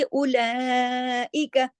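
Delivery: slow, drawn-out Quran recitation; chanting the verse as a teacher's model; tajweed recitation. Shown as a demonstration. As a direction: recite it slowly with careful tajweed. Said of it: "इस वजह से द्वाद को मोटा पढ़ेंगे".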